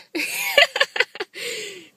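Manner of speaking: high-pitched put-on cackle; little cackle. Shown as a demonstration. Woman showing the laugh that scares guys away, cackle. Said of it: "do the little cackle thing".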